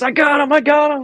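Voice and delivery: high-pitched, urgent retold report; excited teammate. Teammate running back to report a capture, excited. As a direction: imitate a runner bursting with news. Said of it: "I had a guy running back. He goes".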